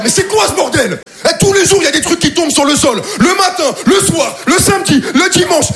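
high-pitched furious complaint; angry rant. Retold or imitated. Neighbor opens the door, high-pitched mock angry rant.

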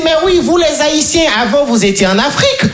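high, relieved granny voice; delighted old lady. Retold or imitated. Yvonne hears the joke and is delighted, high granny voice. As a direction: chirp it with relief.

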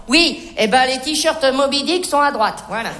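ordinary talk, nothing marked

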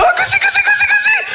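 put-on very high squealing baby talk; tickling baby talk. Son tickling his father, very high squealing baby talk. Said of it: "Tu lui fais".

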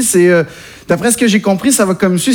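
ordinary delivery

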